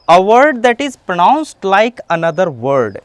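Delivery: very slow, word by word definition; slow careful classroom dictation; slow dictionary reading. Shown as a demonstration. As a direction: spell out the meaning slowly for students. Reads English definition aloud.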